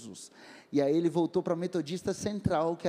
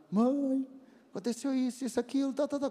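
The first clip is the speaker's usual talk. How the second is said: high-pitched imitation of crying to mum; mock tearful; 7 semitones higher than usual. Son phoning his mother from the club, mock tearful. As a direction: retell it in a high, whiny plea.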